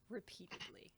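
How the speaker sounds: dry breathy whisper under the story; murmured deadpan aside. Real, not acted. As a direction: slip it in under your breath.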